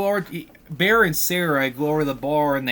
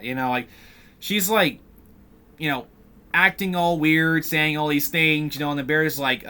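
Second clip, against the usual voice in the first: usual speaking voice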